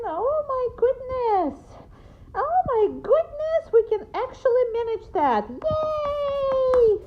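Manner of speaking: put-on high, loud baby talk. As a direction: gush over the dog like a baby. Praising the dog.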